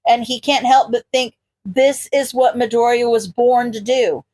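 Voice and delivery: ordinary delivery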